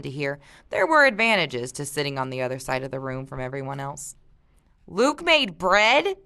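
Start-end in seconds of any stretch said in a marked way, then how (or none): 4.9-6.2 slow, higher, disbelieving question; can't believe Luke baked, stretch it out; incredulous. A narrator's reading. Reader voices Mark doubting Luke baked bread, incredulous. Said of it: "Mark said incredulously".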